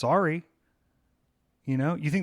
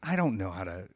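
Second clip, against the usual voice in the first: normal talking voice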